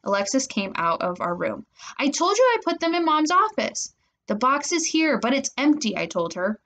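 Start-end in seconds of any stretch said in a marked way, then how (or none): 2.0-3.9 high, exasperated comeback; irritated retort. A narrator's reading. Sister snapping back in quarrel. 4.2-6.0 higher, insistent complaint; angry protest. A narrator's reading. Arguing back about empty box.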